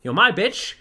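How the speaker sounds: loud mock shout, pitched high; hammed-up yelling claim; possessive retort. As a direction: bark it at him as a jealous rival. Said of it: "He's like".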